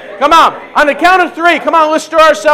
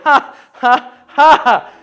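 forced laugh with more gusto; stirred-up laugh; much slower than usual. Shown as a demonstration. Preacher stirring joy up a bit, demonstrated laugh. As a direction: stir the ha-ha up a little. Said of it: "start stirring it up a little bit".

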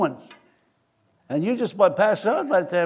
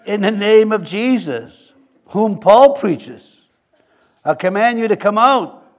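loud, slow, raised commanding voice; 5 semitones higher, 7 dB louder, slower than usual. Acted. Exorcists ordering an evil spirit out, loud slow command. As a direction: command it out with drawn-out force.

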